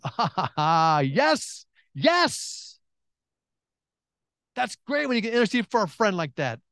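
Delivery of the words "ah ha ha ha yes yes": retold jubilation. Retelling his delighted reaction.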